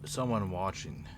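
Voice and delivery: quiet, low game-master narration; eerie scene setting. Acted. Game master describing watching eyes and the dead, eerie narration. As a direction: set an ominous scene, softening toward the end.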